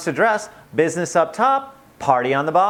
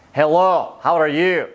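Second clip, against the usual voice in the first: loud, hammed-up Scottish greeting; Scottish accent; 6 dB louder, slower than usual. Shown as a demonstration. Host demonstrating vocal range to viewers, playful Scottish accent. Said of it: "here's my Scottish accent".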